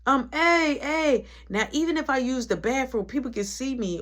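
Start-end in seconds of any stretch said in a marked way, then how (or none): 0.3-1.3 high-pitched calling out; yelling. Retold or imitated. Cold inmate calling the guards, high mock yell. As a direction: imitate yelling down the hall, pitched up. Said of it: "that's you yelling?".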